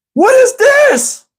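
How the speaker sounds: very high, slow, loud jeering shout; mocking shout. Kid jeering at chocolate-smeared Steven, high and shouting. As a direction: jeer at him loudly.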